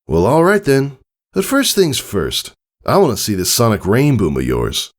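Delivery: breezy, a bit boastful; friendly older stallion. Acted. Tycho telling Scootaloo about learning it, casually.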